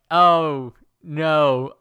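loud hammed-up alarm; mock scared. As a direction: cry out like a frightened cub. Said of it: "Coda is scared".

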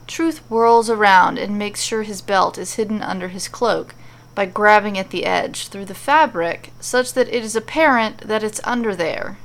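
ordinary delivery

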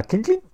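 very high little clink noise; tiny high-pitched sound effect; imitated metallic clink. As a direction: make the sound of metal snapping on.

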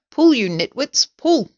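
high, loud command to heave; impatient shout, rising in pitch; shouted order. A narrator's reading. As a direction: holler at a useless work crew. Bossing helpers hauling carpet.

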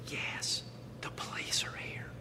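put-on hushed little-boy whisper on the phone. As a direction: whisper it very softly, as the child. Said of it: "answers the phone with a whisper".

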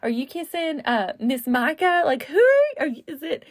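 high, mock-wounded whine at her son; hammed-up jealous mom; mock jealous teasing. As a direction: pretend to be heartbroken, playfully. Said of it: "I'm like".

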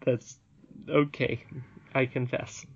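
ordinary delivery

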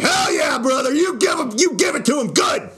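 hammed-up drunk cheerleader voice; drunk cheering. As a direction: whoop him on like a tipsy heckler at the back. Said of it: "But I'm like".

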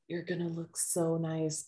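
whisper-like threat over a victim; creepy hush. Retold or imitated. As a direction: ghoul praising her meal softly. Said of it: "she's like".

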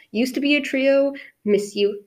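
ordinary talk, nothing marked

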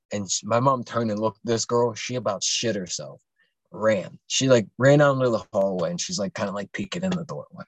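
ordinary talk, nothing marked

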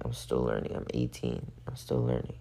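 soft aside to self; soft muttered repeat. Real, not acted. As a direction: drop to a near whisper, to yourself. Repeats it quietly to herself.

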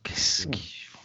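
whispered mock disgust, falling. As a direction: breathe out the disgust.

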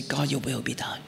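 soft hushed yielding prayer; surrendered whisper. Retold or imitated. Pastor retelling his final prayer, whispered surrender. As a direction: breathe it out in full surrender. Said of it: "to reach the point to say".

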